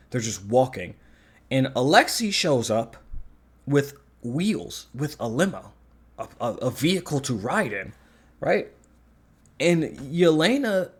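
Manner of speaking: normal talking voice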